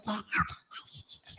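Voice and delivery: unvoiced whisper. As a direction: whisper it conspiratorially. Plotting a surprise quietly.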